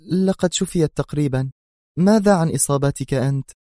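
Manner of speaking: high-pitched female character; faint-voiced woman. A narrator's reading. She turns the question to his injury, in a high female voice. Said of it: "أجابته في خفوت أيضا".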